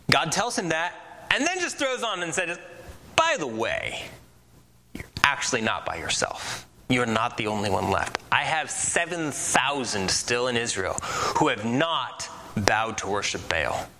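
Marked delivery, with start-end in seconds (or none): 3.2-13.9 low, hushed whisper, easing into a gentle murmur. Retold or imitated. God reassuring lonely Elijah.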